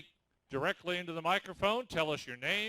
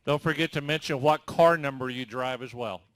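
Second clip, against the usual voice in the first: raised announcer voice to the drivers; loud reminder; 2 semitones lower, 9 dB louder than usual. Real, not acted. Announcer reminds drivers to give car numbers, loudly. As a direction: call it out so all hear.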